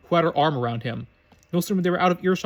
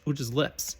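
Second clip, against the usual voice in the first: ordinary delivery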